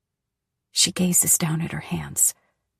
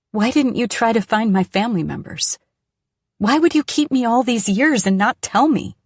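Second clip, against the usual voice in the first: loud, raised accusing questions; angry outburst; 6 semitones higher, 7 dB louder than usual. A narrator's reading. Daughter confronting the dying woman, angry loud demand. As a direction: fling the questions at her, hurt and furious. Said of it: "but I'm still angry".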